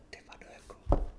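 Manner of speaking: hushed, voiceless muttered oath; whispered disbelief. Retold or imitated. Man retelling his realisation to himself, whispered. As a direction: whisper it to yourself, stunned. Retold stunned self-talk.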